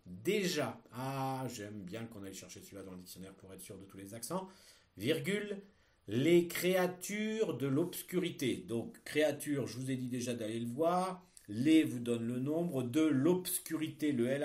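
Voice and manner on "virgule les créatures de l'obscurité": slow, loud dictation; dictating. Shown as a demonstration. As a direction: read it out for pupils to write. Teacher dictating a text.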